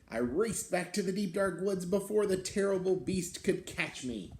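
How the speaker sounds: put-on lively read-aloud for children; storybook reading. A narrator's reading. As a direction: tell it like a picture book to kids. Reads the beast's tale aloud.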